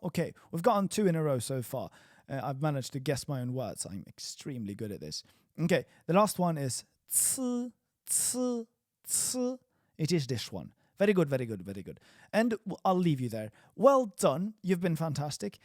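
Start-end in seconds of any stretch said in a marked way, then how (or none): 5.6-9.6 ci said high; ci quiz. Shown as a demonstration. Teacher quizzing students with ci, high. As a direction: say ci crisply. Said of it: "see if you can guess".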